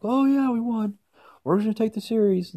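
high, loud mock celebration; overexcited fan. Fans boasting after one win, mock-excited. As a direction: crow like a fan who thinks it's already won.

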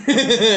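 high, loud burst of laughter; retold laugh. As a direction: show how you laughed at the fall. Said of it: "Así me reí".

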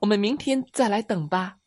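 high frog voice with a croak; smiling little frog. A narrator's reading. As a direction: cheerfully suggest it in a high voice. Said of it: "小青蛙笑着说". (小青蛙笑着高声说)

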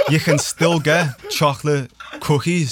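high, loud laughing protest. Real, not acted. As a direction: laugh through the words.

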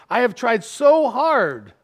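high, loud, drawn-out exasperation; frustrated. Acted. God complaining about his people, exasperated and frustrated. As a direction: stretch it out in frustration. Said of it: "you hear that frustration in his voice".

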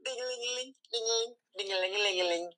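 high, soft ding-a-ling jingle, fast; sung ding imitation. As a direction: chime it like a tiny bell. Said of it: "I'm sorry if that hurt your ears".

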